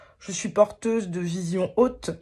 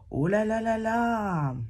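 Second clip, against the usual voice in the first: dismayed oh là là; 3 semitones higher, slower than usual. Real, not acted. Daunted by long English card.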